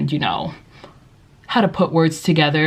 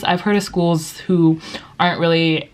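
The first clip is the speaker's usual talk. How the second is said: ordinary talk, nothing marked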